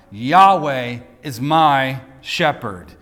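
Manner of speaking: "Yahweh is my shepherd" slow, weight on every word, Yahweh strongest; emphatic slow delivery. Shown as a demonstration. Preacher quoting the psalm's opening line, slow and emphatic. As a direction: stretch out "Yahweh", then land "is my shepherd" deliberately. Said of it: "Emphasize any word you want".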